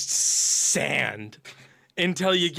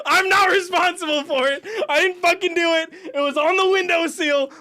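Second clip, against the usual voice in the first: high, loud defensive insisting; flustered protest; 12 semitones higher, 6 dB louder than usual. Real, not acted. Man defending himself about a mystery bracelet, loud high protest. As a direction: plead innocence at the top of the voice.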